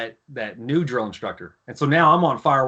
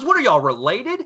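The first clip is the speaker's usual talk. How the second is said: incredulous question; 7 semitones higher, 3 dB louder than usual. Retold or imitated. Officer interrogates two recruits.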